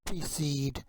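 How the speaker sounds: whispered "Poppy seed." unvoiced and soft; whispered word preview. Shown as a demonstration. Word read before model.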